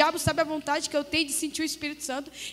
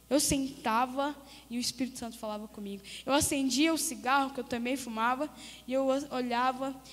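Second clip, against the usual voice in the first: usual speaking voice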